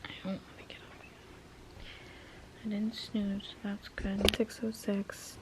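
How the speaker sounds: groggy whisper just after waking; sleepy morning whisper. Real, not acted. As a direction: whisper so nobody wakes up, still half asleep. Waking up early, partner asleep.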